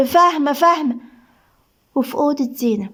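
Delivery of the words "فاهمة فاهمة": higher-pitched, fearful compliance; frightened. Acted. Nurse submitting to the doctor's threat, frightened. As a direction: give in with mild alarm. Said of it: "صباح بخوف ورعب".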